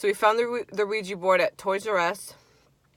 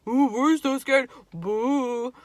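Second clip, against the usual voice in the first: high, drawn-out mock fear; sarcastic spooky taunt, stretched out; 6 semitones higher, 4 dB louder, much slower than usual. Friends pretending to be scared of the ghost, mocking. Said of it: "we start mocking the ghost".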